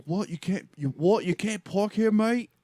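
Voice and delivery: imitating a British customer with a hammy British accent. Mocking the British driver to the parking attendant in a British accent. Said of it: "for that accent".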